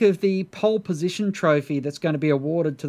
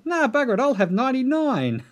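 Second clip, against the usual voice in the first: pitched way up, breezy and cheeky; carefree high voice; 9 semitones higher than usual. Retold or imitated. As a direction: toss it off lightly, up high. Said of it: "he's, uh, decided".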